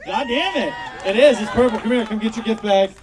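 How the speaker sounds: loud, high-pitched outburst; excited exclamation. Real, not acted. Host finding the audience guessed the color, loud excitement. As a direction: burst out, then call the winner up.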